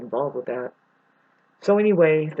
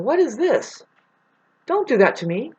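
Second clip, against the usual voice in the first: high, alarmed cry of a retold panic; retold freak-out; 7 semitones higher than usual. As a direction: pitch it up like you're panicking at the fence. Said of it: "And I am freaking out".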